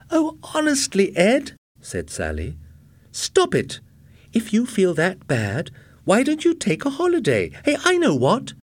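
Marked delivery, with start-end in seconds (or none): 0.1-1.6 high-pitched, impatient wife voice; exasperated young wife. A narrator's reading. 3.1-8.5 high-pitched, impatient wife voice; exasperated young wife. A narrator's reading.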